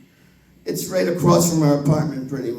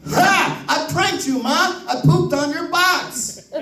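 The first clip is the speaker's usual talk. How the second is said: put-on high, proud toddler crowing; gleeful little kid; 9 semitones higher, slower than usual. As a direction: kid bragging about his prank. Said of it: "My grandson comes out and says".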